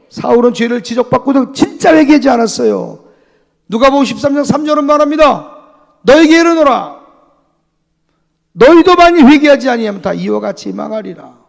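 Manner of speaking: loud and high scripture proclamation; fiery preaching. Acted. Pastor proclaiming Luke to the church, loud and high. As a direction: thunder the verse at them.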